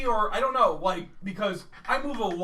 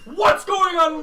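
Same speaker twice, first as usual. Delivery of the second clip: loud, higher confused shout; startled shout; 10 semitones higher, 9 dB louder, slower than usual. Retold or imitated. Roommate jolted awake by yelling, confused shout. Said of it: "Jason's like".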